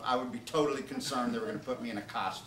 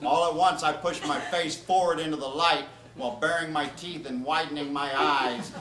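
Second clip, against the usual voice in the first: ordinary talk, nothing marked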